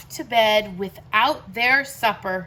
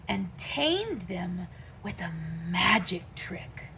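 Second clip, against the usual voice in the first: hushed storyteller whisper; whispered magic trick; 6 semitones lower, 10 dB quieter than usual. A narrator's reading. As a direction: breathe it like a secret spell.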